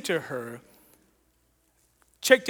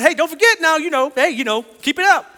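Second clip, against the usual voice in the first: cheerful encouragement; 7 semitones higher, 6 dB louder than usual. Retold or imitated. Cheering the lady on, teasing.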